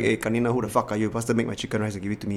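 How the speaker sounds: low, fast, understated rude demand; rude customer. Retold or imitated. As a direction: imitate an impatient customer under your breath. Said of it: "You know, that kind of thing".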